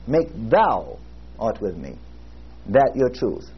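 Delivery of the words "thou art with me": "thou" drawn out alone, then a pause; stress on thou. Shown as a demonstration. Preacher urging listeners to own the verse, stress on "thou". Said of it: "Emphasize every word and make it yours".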